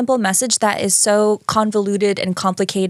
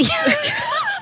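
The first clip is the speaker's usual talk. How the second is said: a very high burst of a laugh-shout; shrieking laugh. Real, not acted. Mocking her co-host's long wind-up, a shrieking laugh.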